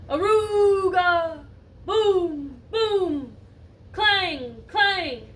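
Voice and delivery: slow drawn-out Aruga then loud booms and clangs; loud echoing noise. Acted. Noise echoing up the laundry chute, loud and slow. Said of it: "the noise echoed up the chute".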